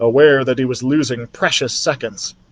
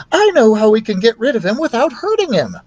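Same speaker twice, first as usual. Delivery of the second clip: higher, pleased with her idea; eager teacher voice; 8 semitones higher than usual. Acted. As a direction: share the plan brightly. Betty's excited plan.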